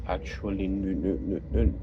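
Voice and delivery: ordinary talk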